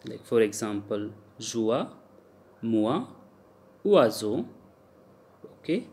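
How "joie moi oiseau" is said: "joie, moi, oiseau" with oi said as "wa"; French "oi" sound. Shown as a demonstration. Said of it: "O I is pronounced as oa".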